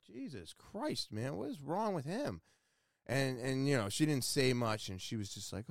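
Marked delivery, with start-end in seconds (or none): 0.1-2.4 exasperated. Retold or imitated. Baffled by the rude installer.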